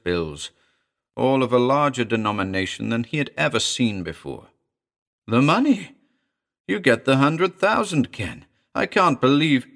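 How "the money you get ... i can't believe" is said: high, loud disbelief; excited. A narrator's reading. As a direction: shout it out in amazement. Friend sees the money.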